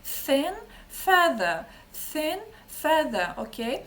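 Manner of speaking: "Thin" with a breathed th, "feather" with a voiced th; th drill. Shown as a demonstration. Teacher drills both th sounds. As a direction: model thin and feather for the class. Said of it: "Прикладом для звуку".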